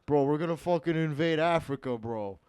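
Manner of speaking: loud Jersey Italian bro as Mussolini; Jersey Italian accent. Retold or imitated. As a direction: hype it up, Jersey-style Mussolini. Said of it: "I feel like I'm talking to Mussolini".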